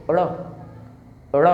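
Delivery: ड़ flicked off the roof of the mouth; retroflex flap ड़. Shown as a demonstration. Teacher modelling ड़ for students to repeat. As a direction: snap the tongue for ड़. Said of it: "आप बोलिए".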